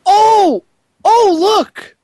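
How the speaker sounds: high, loud mock surprise; sarcastic excitement. Real, not acted. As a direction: gasp in fake delight at the news.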